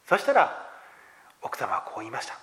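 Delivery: normal talking voice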